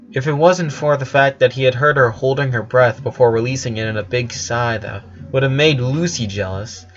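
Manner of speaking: ordinary delivery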